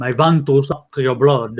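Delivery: put-on slow, loud vampire accent; Dracula accent. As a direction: ham up the Transylvanian count. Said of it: "that accent all the time".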